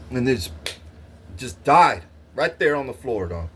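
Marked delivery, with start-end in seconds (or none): none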